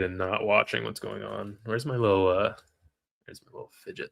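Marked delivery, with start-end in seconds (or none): 3.2-4.1 whispered, searching for the fidget. Real, not acted. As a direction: breathe it under your breath. Muttering while searching.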